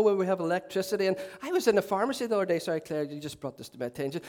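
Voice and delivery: ordinary talk, nothing marked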